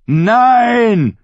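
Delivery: hoher, gedehnter Schrei mit fallendem Ende; lauter Aufschrei. A narrator's reading. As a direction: schrei deinen Widerstand heraus. Ritter stellt sich den Wachen entgegen. (lautes, trotziges Schreien)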